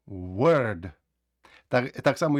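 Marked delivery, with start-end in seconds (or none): 0.1-0.9 Word said with an e-like vowel, "wörd", falling. Shown as a demonstration. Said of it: "Складаємо на W".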